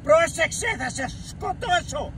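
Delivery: high-pitched shouted warning; angry threat. Real, not acted. Grandfather warning the youth off, high angry threat.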